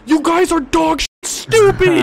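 slow, loud furious yell; angry yell. Real, not acted. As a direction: bellow it slowly in frustration. Frustrated yelling at teammates.

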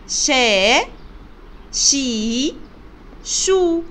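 شا، شي، شو each sounded separately with a long vowel; letter-sound demonstration. Shown as a demonstration. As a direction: model شا، شي، شو one by one, drawn out for learners. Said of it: "نشوف أصوات حرف الشين".

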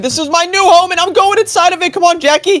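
high, eager shout; thrilled kid rushing for a new toy; excited claim. Pretend. Thrilled about the new spaceship.

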